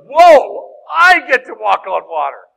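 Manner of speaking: the thrill of a discovery; excited whoa. Retold or imitated. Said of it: "he goes".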